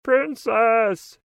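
loud, high frog calling. A narrator's reading. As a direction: call out for the princess. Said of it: "he says".

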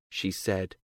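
ordinary delivery, nothing marked

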